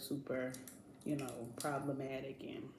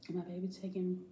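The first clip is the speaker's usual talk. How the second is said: normal talking voice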